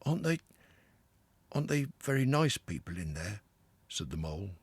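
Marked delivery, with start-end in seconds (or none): 0.0-3.4 mild, hesitant worry; a trifle nervous. A narrator's reading. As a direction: ask with a small catch of nerves. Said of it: "a trifle nervously".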